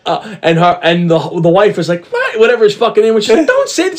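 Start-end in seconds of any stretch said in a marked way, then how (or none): 2.1-2.4 very high-pitched mock outrage; imitated appalled wife. Wife hearing her husband's tan line advice, appalled. 3.5-4.0 high-pitched mock scolding; imitated scolding wife. Wife stopping her husband mid-advice, scolding.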